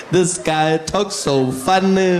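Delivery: Zuma cracking up at the posh speaker; hammed-up Zuma imitation of a posh accent; mocking Zuma. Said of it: "and he's like".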